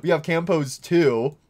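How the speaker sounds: normal talking voice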